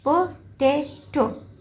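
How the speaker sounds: पोटैटो slowly split पो, टै, टो; three separate beats in पोटैटो; syllable by syllable. Shown as a demonstration. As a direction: pause between each piece of पोटैटो. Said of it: "दरमियान में dots लगाने हैं".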